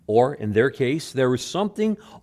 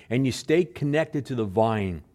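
normal talking voice

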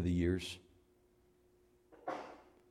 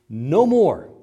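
loud, emphatic no more; a loud final no more; firm refusal; 5 semitones higher, 13 dB louder than usual. Acted. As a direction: slam the door on fear. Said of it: "it says".

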